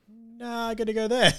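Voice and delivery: high, put-on dopey guy voice; a lovestruck bloke changing his mind; mock Chris Pine. Chris Pine waking to Charlize Theron, hammed-up high voice.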